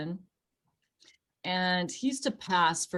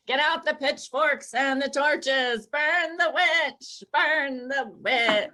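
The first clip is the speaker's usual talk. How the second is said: high, loud, slow mob chant; rally the villagers to burn her; mock angry mob; 7 semitones higher, 4 dB louder, slower than usual. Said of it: "Talk like you're from a nineteen forties film noir movie".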